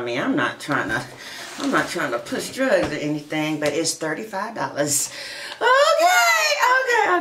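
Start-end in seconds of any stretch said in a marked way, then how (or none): 5.6-7.2 high, laughing okays. Real, not acted. Reader cracking up at her own joke, laughing.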